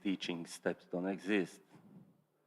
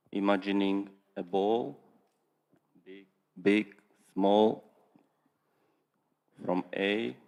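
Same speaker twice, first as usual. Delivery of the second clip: louder, to be heard; speaking up; 8 dB louder, slower than usual. Real, not acted. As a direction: raise your voice so they hear. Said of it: "your audio was not that audible".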